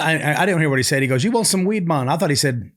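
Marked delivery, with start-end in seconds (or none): 1.2-2.1 higher-pitched mock Jamaican offer; attempted Jamaican accent. Beach worker offering weed to a tourist, imitated Jamaican accent. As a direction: have a rough go at a Jamaican accent. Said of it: "I can't do the accent".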